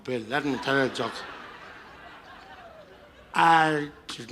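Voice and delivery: put-on tipsy old statesman, halting and repetitive; Madiba. Drunk host joking with Bill Clinton, slurred impression. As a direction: mimic a drunk elderly leader hailing guests. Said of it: "get totally wasted on his birthday".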